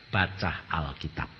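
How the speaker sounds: low, soft, slow "Baca Alkitab"; voice of God. Retold or imitated. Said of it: "Tuhan berkata".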